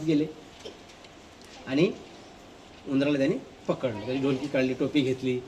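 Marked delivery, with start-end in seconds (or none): none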